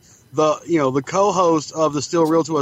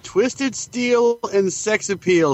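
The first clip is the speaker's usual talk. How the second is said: slow mock quote; 5 semitones higher, much slower than usual. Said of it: "quoting the catchphrase".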